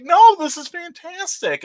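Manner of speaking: high, slow, delighted insistence; excited wife. Retold or imitated. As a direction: gush it like a proud wife. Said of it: "and she's like".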